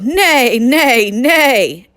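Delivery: loud, high neighs, each falling; bellowing horse. Acted. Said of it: "Horse bellowed".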